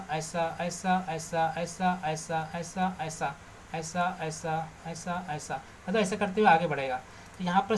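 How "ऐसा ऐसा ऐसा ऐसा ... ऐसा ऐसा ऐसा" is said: same word softly repeated in even beats; quiet, absent-minded repetition; soft repetitive murmur. Real, not acted. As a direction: count off each stroke softly while drawing. Repeats word while drawing light rays.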